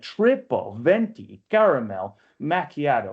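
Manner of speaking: TRIPLE, VENTI, CARAMEL, MACCHIATO each hit separately and slowly; slow, weighty beats on "triple venti caramel macchiato"; heavy separate stresses. Shown as a demonstration. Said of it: "It's very rough. It's like rocks in your mouth".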